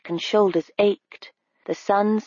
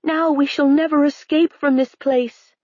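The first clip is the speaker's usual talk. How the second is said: high, hopeless voice; high dismayed voice; despairing Harve; 7 semitones higher than usual. A narrator's reading. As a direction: mourn being trapped. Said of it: "Harve cried".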